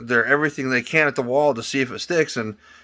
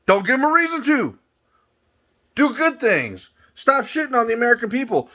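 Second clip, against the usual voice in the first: high exasperated scolding; 11 semitones higher than usual. Real, not acted. Scolding the New York police department, high exasperated lecture.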